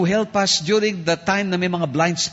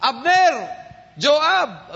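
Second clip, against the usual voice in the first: shouting names; 8 semitones higher, much slower than usual. Retold or imitated. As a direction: yell up from the hilltop to wake them.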